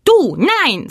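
high, loud bird retort; stubborn retort. A narrator's reading. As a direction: snap it back like a cheeky bird. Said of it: "sagte der Plappergei".

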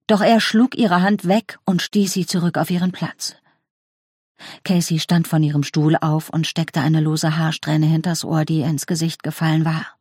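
plain reading voice

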